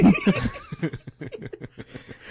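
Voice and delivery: long high cackle; helpless high-pitched laughing; burst of laughter. Real, not acted. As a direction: crack up at your own teasing. Laughing at own princess jibe.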